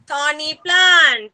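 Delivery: slow, drawn-out THORNY PLANT; teacher modelling. Shown as a demonstration. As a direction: stretch out "Thorny plant" for young children. Teacher models the answer for children.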